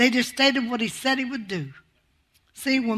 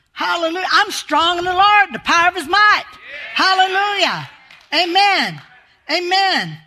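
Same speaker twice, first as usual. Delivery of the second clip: builds higher and louder into a shouted cry; rising preaching to a shout; 5 semitones higher, 5 dB louder than usual. Acted. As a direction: preach it up until you're shouting.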